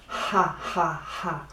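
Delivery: slow "ha ha ha" with a throaty Spanish J; Spanish J laugh. Shown as a demonstration. Teacher to students, slow throaty Spanish J laugh. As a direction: breathe each "ha ha ha" from the throat, slowly. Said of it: "do it with la letra J".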